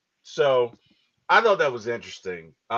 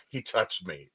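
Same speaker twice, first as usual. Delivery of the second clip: a hushed, sulky Harry mimic; petty mimic; 6 semitones lower, 9 dB quieter than usual. Mimicking Harry's petty grudge, a softer sulky voice. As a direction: mutter it like a wounded rich kid.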